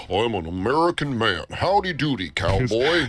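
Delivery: mock American. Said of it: "And he says to me".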